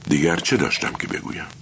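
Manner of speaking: ordinary delivery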